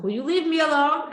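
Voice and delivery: put-on high, loud kid's whine; whiny daughter. Daughter fed up with nagging, whining loudly.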